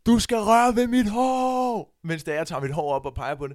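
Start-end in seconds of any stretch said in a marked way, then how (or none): 0.1-1.9 high, loud drawn-out crowd yell; shouting. Retold or imitated. Partygoer yelling at the performer on stage, shouted. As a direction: holler it up at the stage, stretching the last word. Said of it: "og så råber jeg bare".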